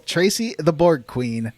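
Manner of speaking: ordinary talk, nothing marked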